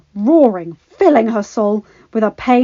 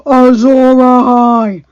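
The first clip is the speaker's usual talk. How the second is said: louder, slow thundering call; 4 semitones higher, 13 dB louder, slower than usual. Acted. Robb raising Ice, thundering. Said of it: "thundered Robb".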